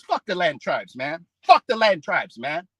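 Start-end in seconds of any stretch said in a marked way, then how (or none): none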